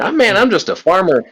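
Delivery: high, plaintive farmer; humble farmer voice. Retold or imitated. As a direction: play the poor humble farmer. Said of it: "It's like".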